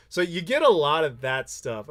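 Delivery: ordinary talk, nothing marked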